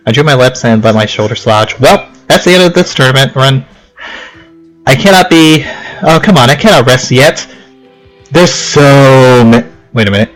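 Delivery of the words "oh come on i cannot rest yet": ordinary talk, nothing marked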